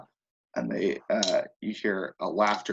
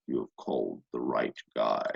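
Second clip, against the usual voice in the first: deep low demon voice; pit fiend demon; 13 semitones lower, 5 dB quieter than usual. Acted. Pit fiend answers the cultists, deep and booming. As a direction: rumble it low like a summoned fiend. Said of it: "He says".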